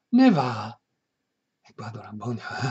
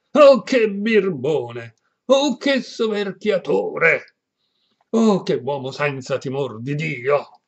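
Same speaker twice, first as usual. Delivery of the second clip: loud, slow, high exclamation of outrage; outraged cry; 4 semitones higher, 8 dB louder, slower than usual. Acted. Perpetua cries out against the bully, loud scandalized exclamation.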